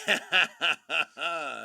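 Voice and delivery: real loud chuckle through the word; laughing agreement. Real, not acted. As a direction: burst out laughing as you agree. Bursts out laughing on stream.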